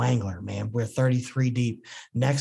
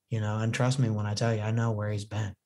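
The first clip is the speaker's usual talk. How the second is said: ordinary talk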